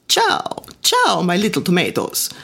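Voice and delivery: warm Italian immigrant greeting; cheerful Italian accent; thick Italian accent. Retold or imitated. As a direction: lean into a heavy Italian accent. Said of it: "His Italian accent was so thick".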